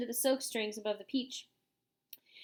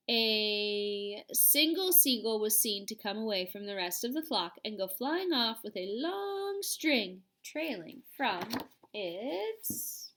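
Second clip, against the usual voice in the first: usual speaking voice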